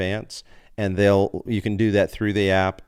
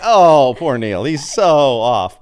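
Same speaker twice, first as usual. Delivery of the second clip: louder, slow, pitying; mock pity; 6 semitones higher, 9 dB louder, slower than usual. Mocking friend Neil's singing, louder slow mock pity. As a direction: pity the bad singer theatrically.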